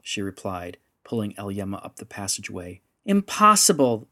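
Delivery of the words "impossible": high, loud cry of disbelief; shout. A narrator's reading. Little girl refusing to believe in cyber creatures, shouting. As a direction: shout it in flat denial. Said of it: "the little girl shouted".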